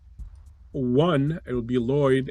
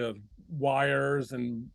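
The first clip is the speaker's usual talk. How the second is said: ordinary delivery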